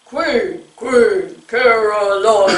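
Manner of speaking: slow, low monster chant; deep low pumpkin voice. Shown as a demonstration. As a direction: drag it out like a frowny pumpkin. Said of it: "deep, low voice".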